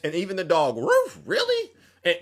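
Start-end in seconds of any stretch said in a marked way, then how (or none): 0.8-1.7 high yelp of a cartoon dog; a dog barking then asking; talking dog. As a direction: squeak it out like a surprised dog. The dog talks in story.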